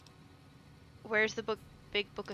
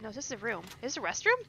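usual speaking voice

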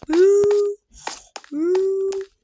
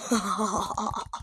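mock evil laugh; 4 semitones lower, 6 dB quieter than usual. Villain laugh after the threat.

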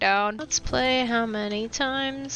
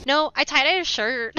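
ordinary talk, nothing marked

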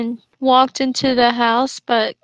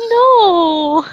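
loud protest; 5 semitones higher, 7 dB louder than usual. Real, not acted. Horrified reaction.

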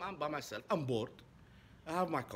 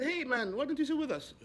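much higher, louder friendly call; cheery invitation; 11 semitones higher, 4 dB louder than usual. Retold or imitated. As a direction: warmly call him over. Inviting man to sit.

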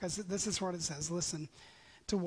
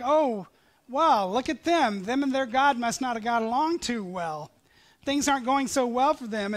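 higher, louder mock gloating; mocking nations; 4 semitones higher, 8 dB louder than usual. Nations jeering at Israel, mock gloating. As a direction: sneer like the scoffing neighbours. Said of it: "nations were now mocking them".

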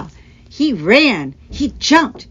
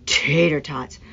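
mumbled; 5 semitones higher than usual. A narrator's reading. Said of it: "D C mumbled".

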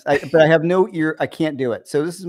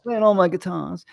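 bad put-on English accent, raised pitch; 4 semitones higher than usual. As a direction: echo it in a mock English accent. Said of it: "Why we go English?".

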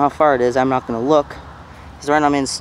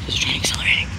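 tense hushed whisper; 7 dB quieter, faster than usual. Real, not acted. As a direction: whisper it, afraid to be heard. Hiding near the tracks.

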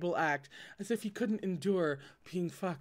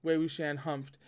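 ordinary delivery

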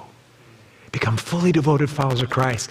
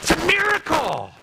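high, hammed-up awe; mock wonder at the fake; sarcastic exclamation. Pastor mocking fake church joy, sarcastic.

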